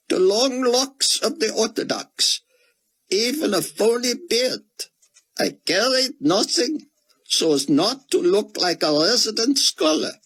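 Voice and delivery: a broken scholar clinging to scripture; grieving Israeli scholar. A narrator's reading. Grieving scholar tells Buck his faith, high and squeaked.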